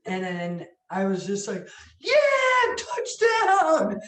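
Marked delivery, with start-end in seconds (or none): 2.0-4.0 very high, loud victory cry; triumphant yell. Retold or imitated. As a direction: yell it like a winning score. Re-enacts celebrating the good post.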